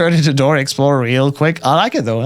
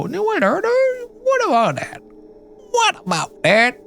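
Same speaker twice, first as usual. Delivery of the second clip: much higher, playful questioning; high put-on voice. Trying out the game's question, high put-on voice. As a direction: pitch it way up, stretch the last repeat.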